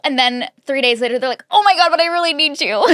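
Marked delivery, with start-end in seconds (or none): 1.5-2.8 high, rushed, laughing plea; hammed-up sudden desperation; mock clingy panic. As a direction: blurt it like a needy ex backtracking. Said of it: "They'll start avoidant and then be like".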